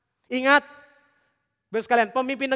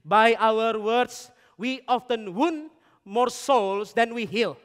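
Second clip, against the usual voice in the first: "By our words, we often wound more souls than we heal" read very slowly, word by word; slow solemn quote; much slower than usual. Shown as a demonstration. Preacher quoting Thomas Kempis to the congregation, very slow. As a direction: read the quote as if carving it in stone. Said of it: "dengarkan kalimat ini baik-baik".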